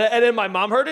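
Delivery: normal talking voice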